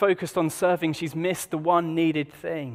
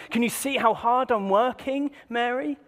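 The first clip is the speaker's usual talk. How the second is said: pointed complaint; 7 semitones higher than usual. Retold or imitated. Martha's imagined pointed complaint.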